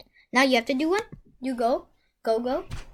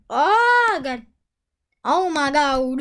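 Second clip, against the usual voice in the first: excited; 7 dB louder, much slower than usual. Real, not acted. Great card pulled.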